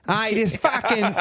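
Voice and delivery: high-pitched mock Aussie complaint; bad Australian accent. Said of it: "doing an Australian accent".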